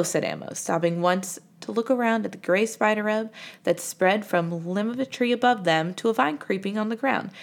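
ordinary delivery, nothing marked

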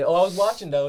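high, loud feigned innocence; mock prison guards. Guard excusing himself after inmate's death, loud mock innocence. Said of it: "That's what they're doing".

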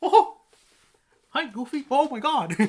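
loud falsetto cartoon mouse; Mickey Mouse. Mickey greeting the werewolf as Goofy, hammed-up cartoon squeal. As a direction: chirp a high greeting, then squeal in mock alarm. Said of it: "cutting to a happy Mickey Mouse".

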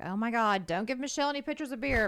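high, loud, rushed warning; acted panicky plea; mock alarm. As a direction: voice girlfriends urgently warning the room. Girlfriends' alarmed warning, retold.

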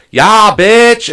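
high, loud yeah bitch; mock hype-girl cheer; hype shout. Imitating a Walgreens clerk cheering her coworker, hyped shout.

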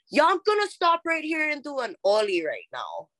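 high, eager announcement to friends; excited announcing. Retold or imitated. To her friends, announcing she'll chant here, high and eager. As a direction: pitch it up like you just spotted the perfect spot. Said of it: "I'm just like".

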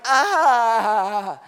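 loud helpless "ah" of a new dad; a hammed-up yelp of alarm; mock panic cry. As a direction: cry out like you're overwhelmed. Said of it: "And I'm like".

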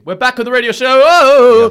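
high, loud intro ending in a big whoop. Shown as a demonstration. Host restarting the radio show, high-energy shout. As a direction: pump it up and holler the last "Oh!". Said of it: "More energy".

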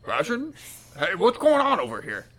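high, loud, nosy guard; second guard. Acted. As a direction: call out as an eager guard butting in. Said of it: "sticks his head around and goes".

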